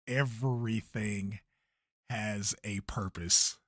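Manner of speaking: slow, low, hushed and mysterious; ominous low murmur. Pretend. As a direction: say it like a dark prophecy. Dramatic ominous repeat.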